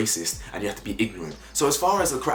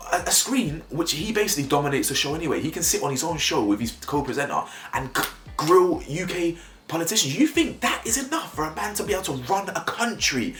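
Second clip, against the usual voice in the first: higher, heated disbelief at Twitter fans; worked up; 6 semitones higher than usual. Real, not acted. Vlogger ranting at viewers about Twitter fans, worked up and incredulous. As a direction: get riled and incredulous, pitch climbing. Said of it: "I'm getting worked up".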